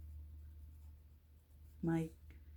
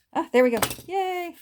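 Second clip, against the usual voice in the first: loud relieved cheer, higher; excited; 10 semitones higher, 9 dB louder than usual. Real, not acted. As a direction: celebrate the punch finally working. Punch finally works.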